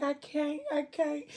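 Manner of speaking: high, small whimpering plea; acted-out helpless protest in a high voice; pleading refusal. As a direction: plead that it is too much. Mother pleading in labour, retold.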